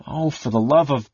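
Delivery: a huff of lost patience; fed up, cutting herself off mid-curse; exasperated outburst, breaking off. Acted. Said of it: "stared at him in exasperation".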